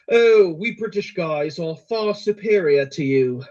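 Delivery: hammed-up, slow mock-British voice; worst British accent. Creator demonstrating his British guy character, mock British accent. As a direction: put on a bad British accent, drawn out. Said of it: "quite possibly the worst British accent of all time".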